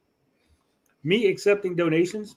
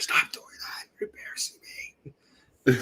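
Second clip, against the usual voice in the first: imitating a mortified stage whisper; embarrassed whisper; 2 semitones lower, 7 dB quieter than usual. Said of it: "he's getting all red".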